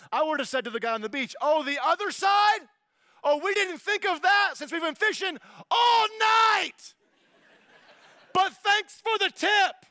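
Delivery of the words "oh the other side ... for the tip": high, loud sarcastic shout; smart aleck. Pretend. Fisherman to the stranger on the beach, smart-aleck shout. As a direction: shout back with mock amazement, hammed-up. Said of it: "what my smart aleck self would have said".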